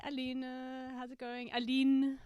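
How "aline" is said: Aline said the French way, stress on the last syllable; French accent. Shown as a demonstration. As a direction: call out Aline with a light French accent, louder. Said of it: "I've gotta get the French accent right".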